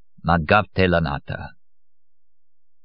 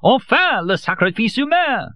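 much higher and louder cry; triumphant shout; 3 dB louder than usual. A narrator's reading.

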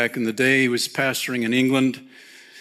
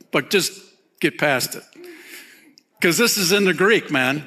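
ordinary talk

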